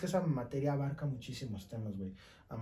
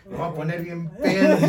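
laughing; 4 semitones higher, 10 dB louder than usual. Real, not acted. Joking retelling amid laughter.

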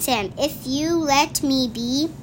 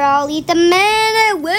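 louder, higher final line; big finish; 5 semitones higher, 6 dB louder than usual. Real, not acted. Child finishing the book for a parent, proud loud ending. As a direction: end the book with a proud burst.

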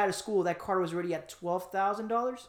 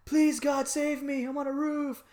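higher pleading voice to God; mock desperate plea; 7 semitones higher, slower than usual. Man on the roof begging God, mock pleading. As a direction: beg God from the roof, lightly hammed. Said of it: "the guy's like".

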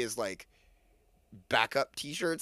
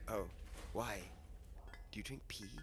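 low, very soft, cautious asking; hushed question; 7 semitones lower, 14 dB quieter than usual. Acted. Kid asks goblin about their drink, very hushed. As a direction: barely voice the awkward question.

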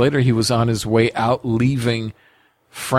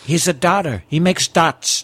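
Trump voice; 4 semitones higher than usual. Pretend. Trump impression continued.

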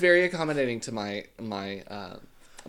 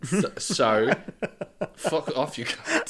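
mock Aussie; 2 semitones higher, 4 dB louder, slower than usual. Mock Australian retort.

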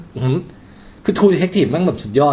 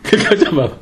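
ordinary talk, nothing marked

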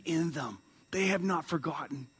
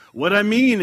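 voiced clerk; 5 semitones higher, 11 dB louder than usual.

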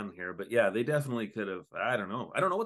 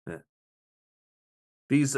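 ordinary talk, nothing marked

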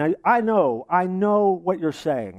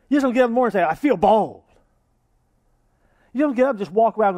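louder preaching; 4 semitones higher, 5 dB louder, faster than usual. Real, not acted. Preaching at full force.

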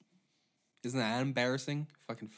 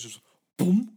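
mock bang; 7 semitones higher, 10 dB louder than usual. Imitating cattle gun sound.

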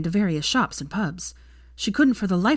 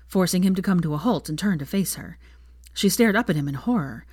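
ordinary delivery, nothing marked

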